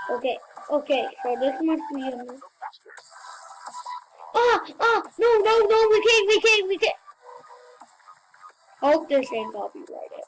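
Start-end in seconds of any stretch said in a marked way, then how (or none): 4.3-7.0 high, urgent repeated protest; frightened, raised-pitch pleading; panicked. Real, not acted. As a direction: blurt it out in a panic. Sudden fright during the game.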